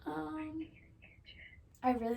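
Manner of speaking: ordinary delivery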